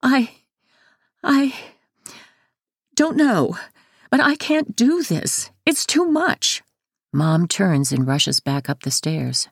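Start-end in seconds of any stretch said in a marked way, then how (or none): 0.0-6.6 high, halting, overwhelmed; falter, then plead at a high pitch; tearful mother. A narrator's reading. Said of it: "Mom says tearily".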